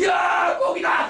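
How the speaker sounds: mock shout of a fisherman spotting fish; fisherman's gleeful cry; excited fisherman's shout. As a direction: yell like you just saw a school of fish. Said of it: "막 그래요".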